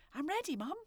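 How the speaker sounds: put-on high eager little girl. A narrator's reading. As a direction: announce it proudly like a child. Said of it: "girl voiced in story".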